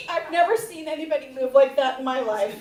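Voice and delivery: ordinary delivery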